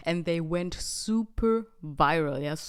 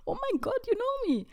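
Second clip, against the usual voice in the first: very high squeal of disbelief, dropping to a whisper on the middle "Oh my God"; overwhelmed that strangers know her; astonished disbelief; faster than usual. Retold or imitated. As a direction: she gasps at being recognized abroad, in squeaky disbelief. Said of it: "And I was like".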